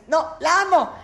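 high, loud delighted gush. Real, not acted. Host raving about the poem she loves, gushing. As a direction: rave about the poem you love.

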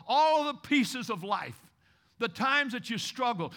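ordinary talk, nothing marked

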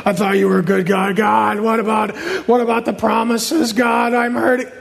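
whiny, monotone griping at God; mock grumbler's whine; whining complaint. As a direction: complain in a droning, put-on whine. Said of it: "Complaining and grumbling is".

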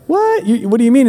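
sudden high-pitched retold disbelief; shocked. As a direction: jump way up on What in shock. Retold shock at hotel bill.

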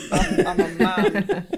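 hammed-up bloke voice, slow and laughing; lightly voiced generic man. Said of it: "a generic man voice".

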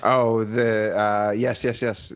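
ordinary talk

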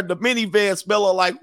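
ordinary delivery

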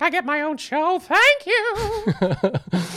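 loud, very high-pitched impersonation; Missus Garrett. Acted. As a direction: belt it out in a high, loud put-on voice. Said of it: "a Missus Garrett impersonation".

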